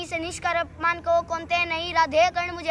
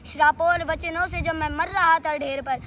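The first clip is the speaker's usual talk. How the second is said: normal talking voice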